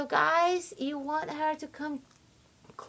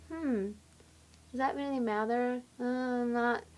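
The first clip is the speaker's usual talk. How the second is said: softer, indifferent partner's muttered doubt; dismissive guy; 5 dB quieter than usual. Acted. Guy hearing his partner complain, quietly dismissive. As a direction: quietly brush the complaint aside. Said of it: "And the guy's there".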